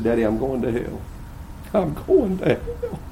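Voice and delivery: soft, pleading, near tears; a daughter begging her daddy through tears; crying daughter. Retold or imitated. Daughter crying to her father in church, soft pleading. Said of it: "she had her arms out crying".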